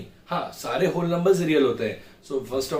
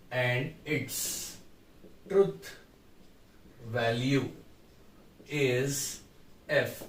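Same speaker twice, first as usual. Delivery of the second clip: slow, quiet, word by word with long gaps; slow dictation while writing; 2 semitones lower, 7 dB quieter, much slower than usual. Shown as a demonstration. Teacher writes the model answer for students, slow and quiet. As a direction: spell it out slowly as if writing on the board. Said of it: "मैं एक का ही लिख के दिखा रहा हूँ".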